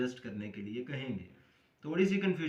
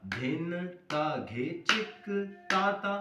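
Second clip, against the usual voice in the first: slow, measured spoken bols; slow rhythm recitation; 5 semitones higher, slower than usual. Shown as a demonstration. Teacher reciting the first part for students, slow recitation. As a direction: stretch out each drum syllable. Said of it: "अब मैंने आपको slow करके".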